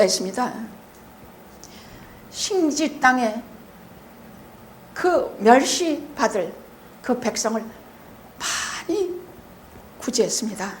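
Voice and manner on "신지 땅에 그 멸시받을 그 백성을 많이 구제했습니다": scripture reading. Real, not acted.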